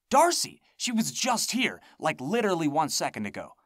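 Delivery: high, loud urgent outburst; excited exclaim. A narrator's reading. Telling Charles she was just there, urgent and excited. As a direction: blurt it out in disbelief.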